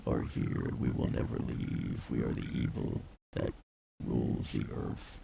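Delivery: low, eerie whispered demon; demonic whisper. Pretend. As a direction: hiss it like a demon.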